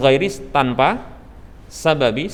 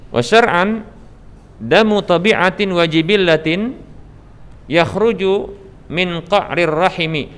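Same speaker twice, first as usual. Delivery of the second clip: Quranic recitation style. Shown as a demonstration. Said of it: "teacher recites Arabic definition".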